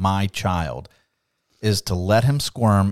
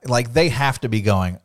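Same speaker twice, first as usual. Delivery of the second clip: normal talking voice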